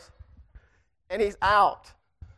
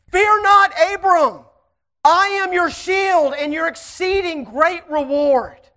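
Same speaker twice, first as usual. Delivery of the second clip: shouted divine words; 7 semitones higher, 7 dB louder, slower than usual. Acted. God's words preached in a shout.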